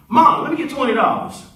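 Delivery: a hint of a kid asking for money; pleading daughter. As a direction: hit Mom up for cash, a touch pushy. Acted daughter asking mother for money.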